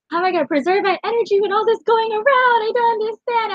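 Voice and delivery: building frantic despair. Retold or imitated. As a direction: spiral into panic, voice climbing. Panic rising in retold thought.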